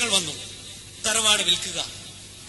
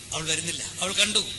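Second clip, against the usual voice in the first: plain reading voice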